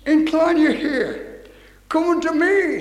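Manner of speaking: high, loud scripture call; ringing proclamation. Real, not acted. Preacher calls God's word to the congregation, ringing proclamation.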